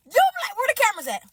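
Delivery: high, fast demand; frantic prank-suspecting cry; incredulous outburst. Acted. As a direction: blurt it out high and quick. Acts disbelief, suspects a prank.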